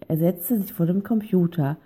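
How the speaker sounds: plain reading voice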